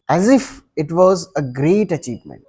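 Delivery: ordinary talk, nothing marked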